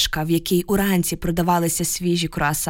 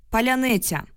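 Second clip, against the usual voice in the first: higher-pitched callback at ordinary volume; loud answer; 5 semitones higher than usual. A narrator's reading. Newcomer giving the password to the guard, restrained call. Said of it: "голосно відповів голос".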